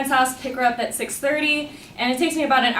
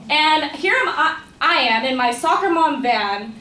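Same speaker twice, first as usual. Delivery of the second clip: ordinary talk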